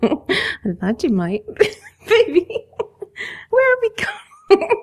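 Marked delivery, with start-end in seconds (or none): 1.5-4.8 high, laughing, breathless question; giggly excitement. Acted. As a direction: laugh as if swept off your feet. Carried off, laughing.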